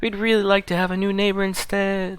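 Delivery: a hammed-up lonely ghost, lightly voiced; exaggerated ghost voice. Ghost Yorick greeting the new graveyard keeper, exaggerated ghost voice. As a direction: ham up the graveyard ghost, mostly in your own voice. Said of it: "exaggerating that ghost, uh, that ghost voice".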